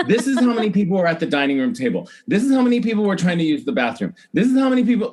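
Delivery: retold rant that climbs in pitch and volume; building excitement. Building rant to elevator crowd.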